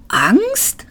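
very high, rising echo question; incredulous cry. A narrator's reading. Passenger hears the lift is afraid, crying out incredulously. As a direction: call it out in disbelief. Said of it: "rief Seyfort".

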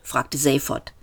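ordinary delivery, nothing marked

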